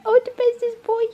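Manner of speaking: high, squeaky cooing pet voice. Real, not acted. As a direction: coo to the dogs like babies. Cooing at the dog.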